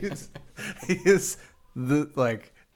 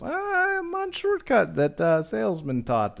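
much higher, slow, halting mock voice; imitating Serge; 9 semitones higher, slower than usual. Lost coworker on the phone, slow high imitation. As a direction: drag out a lost driver's vague answer.

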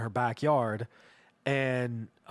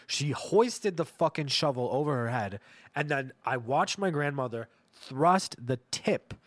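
ordinary delivery

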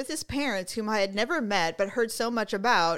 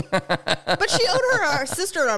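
laughing; 6 semitones higher, 5 dB louder than usual. Real, not acted. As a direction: push the point out while laughing. Laughing while making a point.

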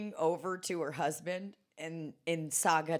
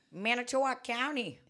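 put-on Wisconsin accent; 7 semitones higher than usual. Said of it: "a fucking Wisconsin accent".

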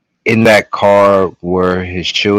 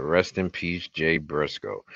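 somber; 7 semitones lower, 10 dB quieter than usual. Real, not acted. Mourning a dead wrestler.

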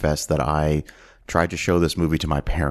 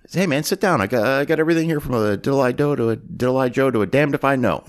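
imitating a pimp's patter; 6 semitones higher, faster than usual. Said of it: "I'm always like".

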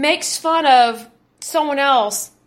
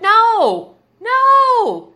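emphatic refusal; 7 semitones higher, 5 dB louder than usual. Real, not acted. Emphatic rejection of the pamphlet's thinking.

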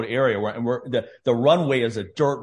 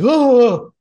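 loud high startled "Oh"; jolted cry of fear; mimicked panicked gasp; 9 semitones higher, 10 dB louder than usual. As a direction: imitate a scared flyer's yelp. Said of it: "like that".

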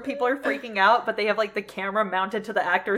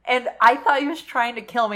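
normal talking voice

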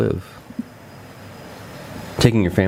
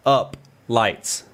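slow demo; much slower than usual. Shown as a demonstration. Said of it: "You just gotta say these three".

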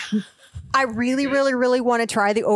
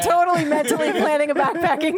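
laughing; 6 semitones higher than usual. Real, not acted. Laughs while admitting plan.

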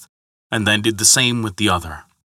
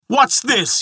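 high-pitched outraged cry; shouted; 13 semitones higher than usual. A narrator's reading. Thor bursting into the hall, shouting angrily. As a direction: burst in demanding answers. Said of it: "he shouted".

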